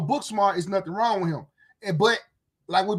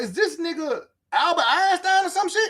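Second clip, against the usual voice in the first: exasperated disbelief; 8 semitones higher than usual. Retold or imitated. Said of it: "So I'm like".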